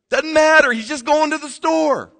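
high, loud dismissive mock yell; mock shout. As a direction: fling it off loudly like it's pointless.

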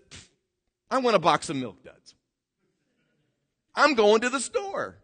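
high, breezy mock Jimmy, loud on the last line; casual whim. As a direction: toss it off like a sudden craving. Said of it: "Jimmy was sitting in his chair one day and says".

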